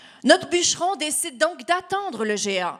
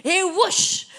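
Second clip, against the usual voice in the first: whooshing gust; 6 semitones higher than usual. Acted. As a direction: blow the word out like wind. Giant's breath blown.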